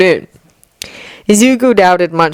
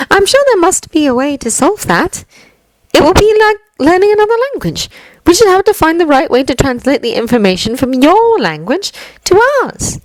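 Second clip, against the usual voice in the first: put-on higher, composed young woman's voice; poised young woman; 12 semitones higher than usual. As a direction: lay it out calmly as her. Yaoyorozu voiced.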